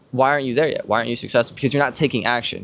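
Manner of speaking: ordinary delivery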